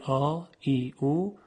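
"آ، ای، او" as drawn-out long vowels; sustained long vowel sounds on "آ، ای، او". Shown as a demonstration. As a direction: hold each vowel long in "آ، ای، او". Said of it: "صداهای کشیدهٔ".

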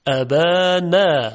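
ابانا slow, open Arabic آ; Arabic long a. Shown as a demonstration. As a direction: stretch ابانا like a Qur'an reciter. (آی عربی کشیده)

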